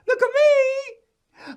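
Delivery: high wailing voice. Acted. High slow cry.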